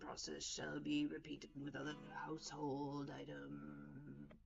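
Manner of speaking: low, slow, hushed reading of a sinister note; slow quiet note reading. Acted. Player reading a found note aloud, slow and hushed. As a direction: read it like a cold surveillance log, slow and soft.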